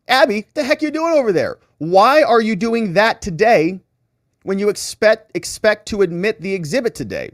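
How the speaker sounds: high, exasperated mock judge; frustrated judge. Judge scolds Abby Lowell over exhibits, high and exasperated. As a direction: scold the lawyer like a fed-up judge. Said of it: "she's frustrated with Abby Lowell".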